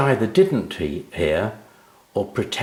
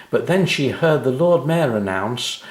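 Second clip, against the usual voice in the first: ordinary delivery, nothing marked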